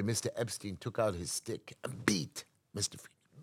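ordinary talk, nothing marked

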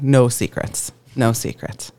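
normal talking voice

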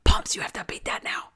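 hushed excited whisper. Real, not acted. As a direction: whisper it like a secret. Host whispers in awe.

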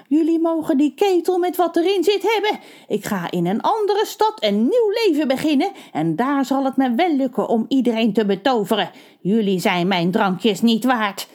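high witch voice, sniffy; haughty witch. A narrator's reading. The witch leaving the townsfolk her kettle, high and sniffy. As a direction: sneer as you leave.

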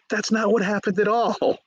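indignant Grendel. Retold or imitated. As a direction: object high as the wronged monster. Grendel protests in retelling.

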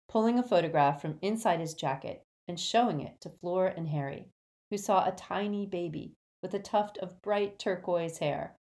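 plain reading voice

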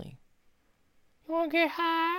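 very high, slow stoner cartoon voice; squeaky, drawn-out cartoon stoner; cartoon character voice. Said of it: "That one, yes".

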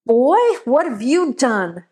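a lightly voiced stern father scolding; a hint of a stern old man catching the boy. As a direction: scold the boy, hard and firm. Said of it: "Mister Johnson's voice said sternly".

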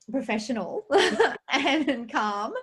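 words broken by a high laugh. Real, not acted. Host laughing while asking the doctor a question. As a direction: giggle through the words.

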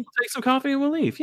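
high, put-on English voice; posh English impression. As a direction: play the polite English guest in a high voice. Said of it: "polite English folks".

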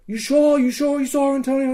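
very high, insistent query; retold urgent questioning. Player doubts Antonio is safe, urgent high questioning. Urgently checking on missing comrade.

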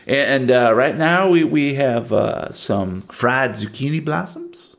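Southern diner waitress listing the menu. Acted. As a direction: rattle off the specials in a Southern accent. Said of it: "Southern one minute and Cajun the next".

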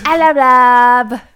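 loud, high, drawn-out mock British greeting; British accent. Joining co-host's [name] joke, loud slow mock British accent. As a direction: holler a hammed-up British hello. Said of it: "she's doing the British accent".

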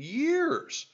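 "years" stretched out very high; emphatic exclamation. Real, not acted. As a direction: push "years" way up in pitch and draw it out. Exclaims how long it has been.

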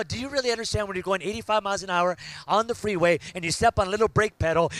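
ordinary talk

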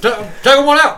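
mock sheepish shout. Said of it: "I'm like".

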